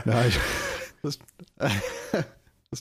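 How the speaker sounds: laughing while answering; breaking into laughter. Real, not acted. Laughing at the remark.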